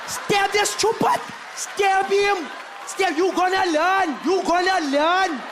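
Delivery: high, loud, slow yelling threats; furious Cape Flats woman. Retold or imitated. Coloured woman with a bottle egging on the stabbing, yelling. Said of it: "comes back with a bottle going".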